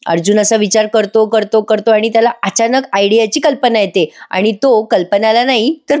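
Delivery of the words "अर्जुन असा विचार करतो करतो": normal talking voice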